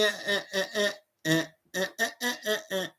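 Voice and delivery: barking eh eh eh; hype-man grunting ad-libs; [name] grunts. Retold or imitated.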